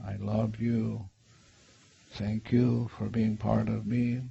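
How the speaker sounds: soft, tender apology with long pauses; hushed, unhurried, sincere; guided meditation. Shown as a demonstration. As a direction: speak gently, as if soothing a child. Models tender words to inner child.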